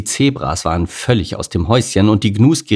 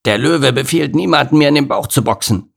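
growled; 6 semitones higher than usual. Acted. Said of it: "knurrte Norman".

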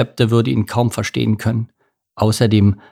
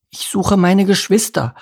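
small salmon voice; 10 semitones higher than usual. Acted. Voices Lars.

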